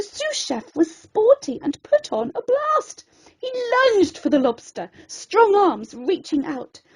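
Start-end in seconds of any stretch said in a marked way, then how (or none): none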